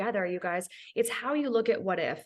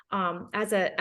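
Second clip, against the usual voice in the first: usual speaking voice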